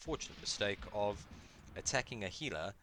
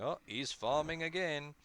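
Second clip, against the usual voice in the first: higher, louder mock viewer groan; bored viewer; 9 semitones higher, 7 dB louder than usual. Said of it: "you guys going".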